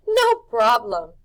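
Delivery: loud, slow, slick showman; drawn-out easy confidence. A narrator's reading. Rictus reassures Harvey about the presents, slow and slick. Rictus voiced in read story.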